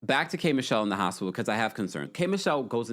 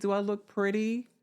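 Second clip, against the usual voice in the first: innocent, hopeful ask, pitched higher; innocent question; 6 semitones higher than usual. Retold or imitated. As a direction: ask sweetly from a hospital bed. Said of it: "she said it so innocently".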